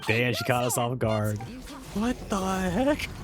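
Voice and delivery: ordinary talk, nothing marked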